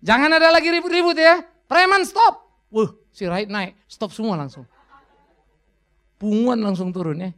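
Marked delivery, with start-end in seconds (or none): 0.0-2.4 high loud commanding order; loud, high mock command; mock shout. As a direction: bark the order at thugs. Mock Batak president shouting.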